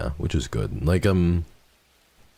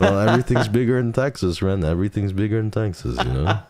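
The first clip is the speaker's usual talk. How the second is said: put-on Texas accent; 7 semitones higher than usual. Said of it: "you got a really good cowboy voice".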